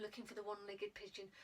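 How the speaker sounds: plain reading voice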